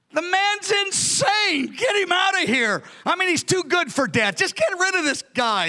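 high-pitched shouted outburst, first words stretched; outraged king. Acted. King Achish ordering the madman out, high exasperated shouting. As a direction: cry out in exasperation, far above your normal pitch.

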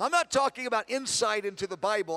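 ordinary talk

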